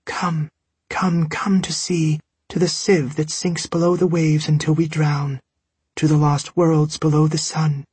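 a ghost calling as it draws near; approaching voice. A narrator's reading. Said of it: "She came closer, calling again".

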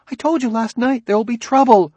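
an anxious wife insisting she warned him; worried wife. Acted. Anxious wife warns her husband.

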